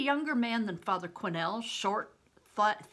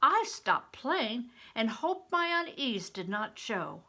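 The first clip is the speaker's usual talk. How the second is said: plain reading voice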